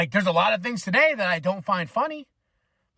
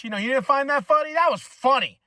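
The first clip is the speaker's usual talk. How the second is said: higher, louder hammed-up scolding; mock berating; 6 semitones higher, 4 dB louder than usual. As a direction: berate them for not laughing, acted. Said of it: "I'm not gonna berate you".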